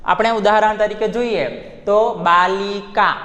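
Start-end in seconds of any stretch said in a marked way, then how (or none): 2.3-3.1 બાલિકા slowly, syllable by syllable with long vowels; pengucapan per suku kata. Shown as a demonstration. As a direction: draw out each syllable of બાલિકા. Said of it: "હવે આપણે ઉચ્ચાર કરીએ શબ્દનો".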